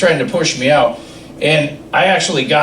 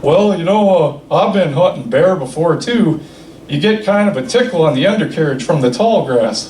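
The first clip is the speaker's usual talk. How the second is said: put-on old Bob voice. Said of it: "He says".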